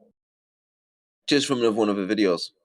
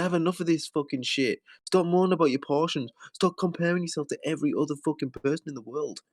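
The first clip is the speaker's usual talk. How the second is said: raised-pitch scolding; exasperated rant; 7 semitones higher than usual. Acted. Ranting at her as if face to face, exasperated. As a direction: let fed-up irritation pour out.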